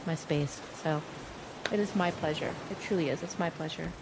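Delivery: ordinary talk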